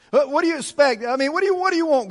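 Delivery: high, pleading exasperation; exasperated. Acted. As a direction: demand an answer, pitch jumping up. Acted exasperated demand to God.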